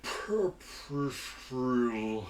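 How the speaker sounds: Pepper shrill drawn out very slowly, each syllable stretched. Shown as a demonstration. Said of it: "Say it slow".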